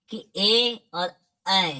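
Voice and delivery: ए and ऐ drawn out very slowly; teacher sounding out ए and ऐ; vowel demo. Shown as a demonstration. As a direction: model ए and ऐ slowly and clearly. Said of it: "इसका उच्चारण स्थान क्या है".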